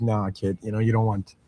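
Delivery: low, soft no-nonsense dad; low, soft, dismissive parent reply; gruff parent. Retold or imitated. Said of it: "It's like".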